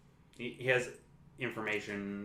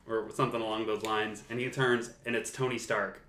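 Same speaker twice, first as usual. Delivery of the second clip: ordinary delivery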